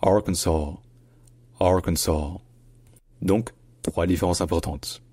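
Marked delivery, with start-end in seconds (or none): 0.0-2.4 "Arkansas" ending on saw, final s silent; "Arkansas" as AR-kun-saw. Shown as a demonstration. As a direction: drop the last s of "Arkansas". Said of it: "C'est juste".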